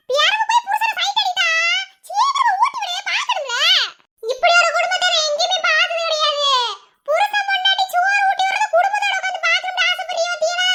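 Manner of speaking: high, carrying rant; high-pitched scolding. Acted. Mocking tirade about spoon-feeding a husband.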